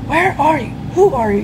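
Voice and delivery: a mild mock shout into the dark. Lost player calling to a hidden voice, mild shout. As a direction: call out, half-hearted alarm. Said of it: "you shout".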